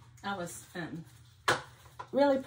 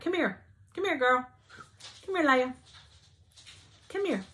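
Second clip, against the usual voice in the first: high coaxing call to a dog; 4 semitones higher than usual. Real, not acted. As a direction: coax the dog over, pitched up. Calling her dog Leia over.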